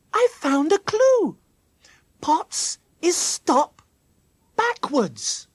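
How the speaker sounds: put-on high, loud, triumphant child character; excited discovery. A narrator's reading. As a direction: announce the clue with great excitement. Jackie announces her clue.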